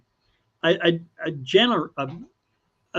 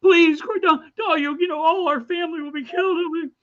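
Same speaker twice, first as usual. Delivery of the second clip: wife pleading; 10 semitones higher than usual. Retold or imitated. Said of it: "they send out his wife and kids".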